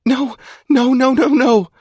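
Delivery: high panicked cry. A narrator's reading. Alex panicking as Zai drags Duncan, high panicked cry. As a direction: blurt it out in panic.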